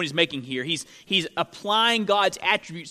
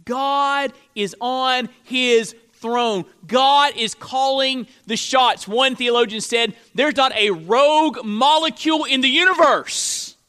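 loud, high, deliberate proclamation; emphatic pulpit voice; forceful preaching; 7 semitones higher, 6 dB louder than usual. Acted. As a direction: declare it to the congregation, hammering each phrase.

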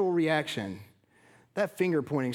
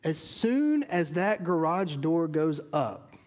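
normal talking voice

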